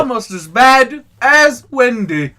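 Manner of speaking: loud, slow fake South African accent; stilted cartoon South African accent; botched South African accent. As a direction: ham it up slowly in a bad South African accent. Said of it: "Why is he South African".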